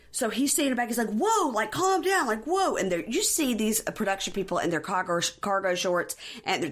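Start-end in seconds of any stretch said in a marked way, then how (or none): 1.1-2.8 raised, high-pitched pleading to settle down; mock alarmed calming. He's standing back from her, high mock-alarmed calming. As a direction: imitate someone trying to halt a meltdown.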